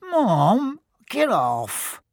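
high, loud whiny squeal; protesting kid. A narrator's reading. Ron squirms away from his mum's handkerchief, loud protest.

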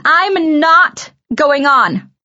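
loud, high, drawn-out protest; loud refusal. A narrator's reading. Girl refusing to go on stage, loud refusal. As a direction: dig in, loud and slow. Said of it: "I said loudly".

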